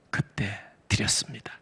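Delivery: whispered aside. Real, not acted. Tender recollection of installation.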